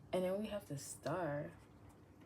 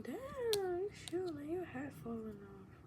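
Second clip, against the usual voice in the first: mock incredulous; 10 semitones higher than usual. Own inner reaction quoted.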